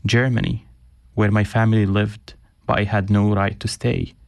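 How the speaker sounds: measured, reflective reading aloud; reading his own writing. Acted. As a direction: read it like a personal essay, each place name set apart. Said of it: "thank you so much for reading that".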